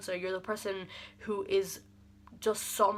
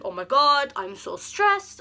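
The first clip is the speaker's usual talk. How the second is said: louder, high and slow, drawn-out panic; stressed panic; 6 semitones higher, 8 dB louder, slower than usual. Retold or imitated. Fan waiting for tickets to drop, high drawn-out panic. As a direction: stretch it out like your nerves are shot.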